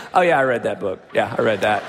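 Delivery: low, lazy show-off "yeah, I read that"; smug offhand boast. Retold or imitated. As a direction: brush it off like a know-it-all who barely skimmed. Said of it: "We like to be able to say".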